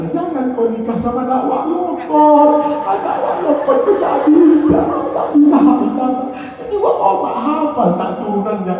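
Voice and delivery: high-pitched mock scolding mother; playing a fussy mom warning her child; nagging mother. Mother scolds child about marriage. (suara ibu cerewet yang tinggi, pura-pura memarahi)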